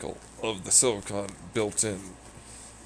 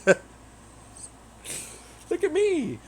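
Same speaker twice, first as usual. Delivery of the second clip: much higher squeaky boast; silly high voice; 3 dB louder than usual. Pretend. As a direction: squeak it out proudly.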